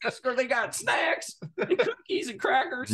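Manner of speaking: high-pitched mock glee; hammed-up excitement. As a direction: gush over every little treat.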